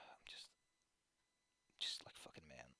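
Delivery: low whisper, fading out; drained. Real, not acted. Streamer at a loss after venting, exhausted whisper. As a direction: barely get the words out, spent.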